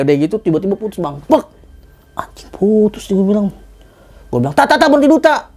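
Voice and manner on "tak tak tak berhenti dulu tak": higher, raised call to stop. Retold or imitated. Hiker calling to friend walking ahead, higher raised call. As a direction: shout ahead for him to wait.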